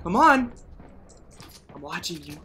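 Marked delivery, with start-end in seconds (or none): 1.7-2.4 much softer, low menacing murmur; creepy murmur. Pretend. Player taunting a hidden opponent, low and soft. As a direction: lurk and taunt softly.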